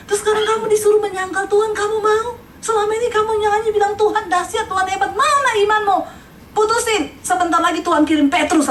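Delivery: high, urgent scolding. Acted. Preacher rebuking the worship leader, high and urgent. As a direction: shake her faith awake.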